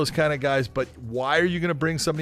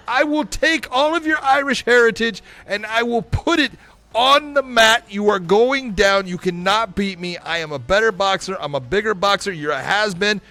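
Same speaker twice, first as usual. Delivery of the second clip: Jake Paul impression; 4 semitones higher than usual. Mock press conference, Jake taunting Conor in a brash influencer voice. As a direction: hype himself up and taunt the rival. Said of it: "[name], you're gonna play Jake".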